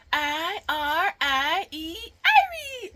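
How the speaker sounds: "I R I E" letter by letter, slowly, then "Irie" high with a laugh; spelling out Irie. Shown as a demonstration. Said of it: "I say".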